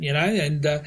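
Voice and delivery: ordinary talk, nothing marked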